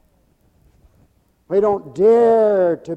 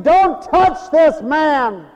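wife's warning; 10 semitones higher, 7 dB louder, much slower than usual. Retold or imitated.

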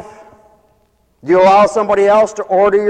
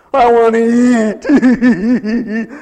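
put-on slow, drawn-out whiny child begging for food, laughing through it; hungry kid voice; much slower than usual. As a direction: play a little kid pleading to eat. Kids begging to be fed.

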